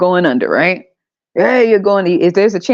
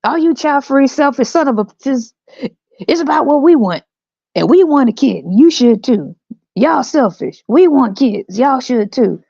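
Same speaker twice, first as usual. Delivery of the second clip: higher, mock-preachy parent voice; mocking parent rant; 7 semitones higher than usual. Comedian mocking parents who lecture the child-free, in a high, preachy voice. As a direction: parody a smug parent scolding the child-free. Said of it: "I want people to see how stupid this shit sounds".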